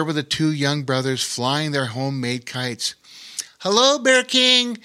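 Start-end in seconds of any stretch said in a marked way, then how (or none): 3.6-4.8 put-on higher, a bit louder boy's call; a boy calling a greeting from afar; yelling out. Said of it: "yelled out Lore".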